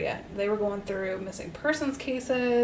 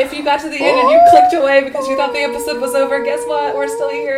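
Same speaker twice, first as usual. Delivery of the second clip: loud, fast, playful reveal; excited announcement; 4 semitones higher, 13 dB louder, faster than usual. Real, not acted. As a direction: burst in with the surprise. Bonus content surprise announcement.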